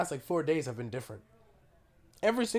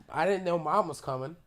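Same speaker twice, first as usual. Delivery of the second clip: normal talking voice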